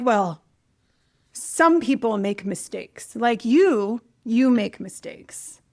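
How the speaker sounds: slow, deliberate put-down from a professor; intimidating professor. Retold or imitated. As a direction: imitate a cold professor, pausing between judgments. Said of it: "this very intimidating German professor".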